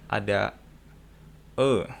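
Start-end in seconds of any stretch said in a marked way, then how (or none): 1.5-1.9 "E" as the Sundanese eu sound; unrounded eu vowel for "E"; Sundanese-style E. Shown as a demonstration. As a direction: say "E" like the eu in Sundanese speech. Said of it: "E-nya mirip kayak orang Sunda".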